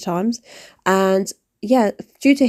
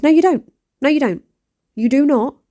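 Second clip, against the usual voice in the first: higher and louder, firm denial; indignant retort; 6 semitones higher, 4 dB louder than usual. Real, not acted. Vlogger firing back at imagined mattress judges, loud and indignant.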